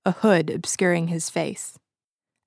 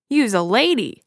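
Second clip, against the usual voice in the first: higher, louder startled cry; shocked exclamation; 4 semitones higher, 4 dB louder than usual. Acted. Ed, staggering back, shocked to find a woman. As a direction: blurt it out, stunned.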